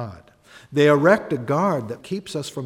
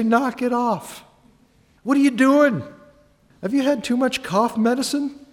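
higher and sharper, fed up with the act; exasperated pushback; 9 semitones higher, 3 dB louder than usual. Acted. Friend telling a pompous talker to stop, exasperated. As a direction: knock it off, incredulous and impatient. Friend reacts to lofty talk.

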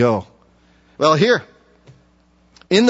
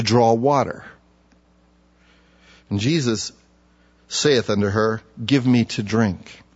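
scripture reading; 6 semitones lower, 3 dB quieter than usual. Acted. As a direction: read the verse with reverence. Reads scripture aloud.

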